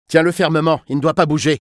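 loud, high-pitched order; urgent command. A narrator's reading. Kidnapper telling his accomplice to hold the boy, loud command.

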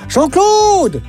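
high calling shout. Acted. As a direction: shout up from the tree. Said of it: "crie-t-il".

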